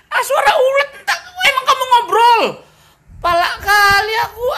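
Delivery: high, loud frustrated cry; frustrated outburst. Acted. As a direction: blow up in mock despair. (meledak kesal)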